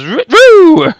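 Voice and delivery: high swooping roo roo sound effect; squiggly swoop noise. Pretend. Teacher miming the curve on the board, high swoop noise. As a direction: make the graph's squiggle with a high voiced swoop.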